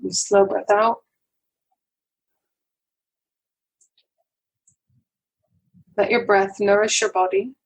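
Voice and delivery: unhurried phrases, leaving room to breathe; guided meditation. Acted. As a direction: guide listeners gently into calm, breath by breath.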